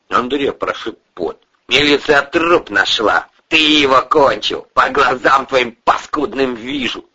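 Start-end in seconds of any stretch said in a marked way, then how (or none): 1.7-7.0 raised, loud and contemptuous; furious accusation. Acted. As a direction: bear down on the suspect. Boss keeps accusing.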